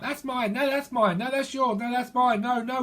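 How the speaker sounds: put-on high-pitched childish bickering; two kids contradicting each other, pitched right up; high tit-for-tat squabble; squabbling kids. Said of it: "that's two kids fighting over".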